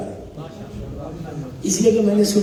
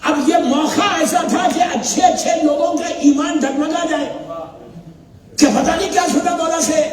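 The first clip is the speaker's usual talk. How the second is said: climactic sermon narration; 8 semitones higher than usual. Acted. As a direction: build the suspense to the breaking point. Climax of the majlis story.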